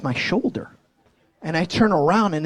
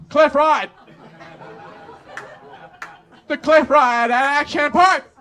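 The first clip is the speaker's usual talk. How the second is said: mock ride announcer; 8 semitones higher than usual. Mocking the park's sign, hammed-up announcer. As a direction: present the cliff like a grand attraction.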